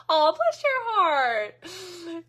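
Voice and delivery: slow, drawn-out mock Southern "bless your heart"; hammed-up Southern drawl, laughing through it; bad Southern accent. Joking with the chat about speaking Southern, in a bad Southern accent. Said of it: "like a Yankee that's trying to do a bad accent".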